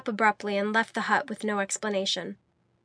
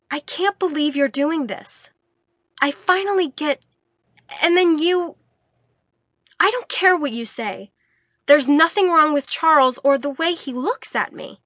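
angry shouting; 6 semitones higher, 6 dB louder than usual. A narrator's reading. Shouting argument with Jens.

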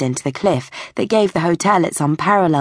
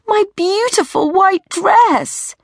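high, slow drawn-out lament; anguished high teenage whine; distressed wail; slower than usual. Acted. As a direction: wail each word over the ruined dress. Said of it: "Emma wailed".

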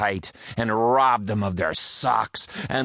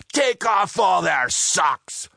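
raised, rallying pirate order; battle order; 9 semitones higher than usual. Acted. Pirate captain ordering his men to attack, raised. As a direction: urge them into the fight. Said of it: "Joe cried out".

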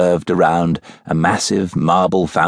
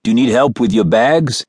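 cab driver voice; 4 semitones higher than usual. Acted.

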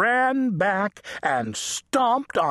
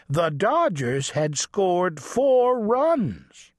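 usual speaking voice

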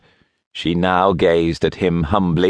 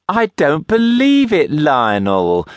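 delighted disbelief; 10 semitones higher than usual. A narrator's reading.

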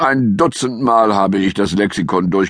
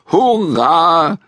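higher, louder "Hurra"; cheering shout; 9 semitones higher, 4 dB louder than usual. A narrator's reading. Jonathan cheering the bear's bath, excited shout. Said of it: "schrie Jonathan".

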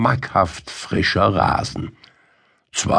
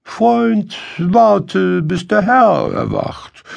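high, measured donkey voice, louder on "warte"; pitched-up donkey, speaking with deliberation; deliberate donkey; 12 semitones higher, 4 dB louder than usual. A narrator's reading. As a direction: put the hungry friend off in a high, deliberate voice. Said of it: "schließlich sprach er mit Bedacht".

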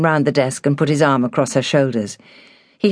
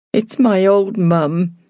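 slow, higher, upset housekeeper; holding back tears; 5 semitones higher, much slower than usual. A narrator's reading. Housekeeper tells Hilary about her mum, holding back tears. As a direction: draw it out, trying not to cry. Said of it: "as she tried to keep from crying".

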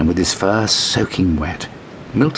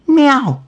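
high falling mew; sad squealed meow; 5 dB louder than usual. A narrator's reading. Kitten squealing sadly as it leaves. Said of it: "squealed Milton sadly".

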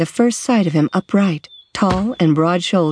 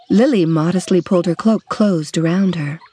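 plain reading voice

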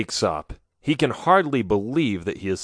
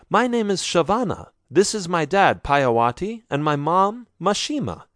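put-on much higher, bright girl's voice; shy enthusiasm bubbling over; eager young woman; 7 semitones higher than usual. A narrator's reading. Shavana amazed that the stranger speaks their language.

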